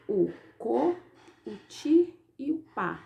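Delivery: "o co, o ti e o pá" as three isolated syllables, a pause after "co"; teacher separating "co", "ti", "pá"; syllables shown singly. Shown as a demonstration. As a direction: set out "co", "ti" and "pá" one by one for pupils. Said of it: "a sílaba tônica do último".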